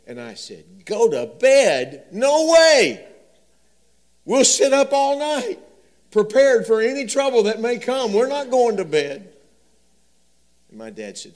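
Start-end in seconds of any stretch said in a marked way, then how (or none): 0.8-9.3 much higher and louder, slow defiant refusal; panicked little boy. Retold or imitated. Son refusing his dad's bedtime, high and loud. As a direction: dig in like a terrified kid.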